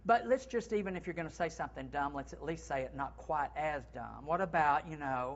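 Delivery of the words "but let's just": usual speaking voice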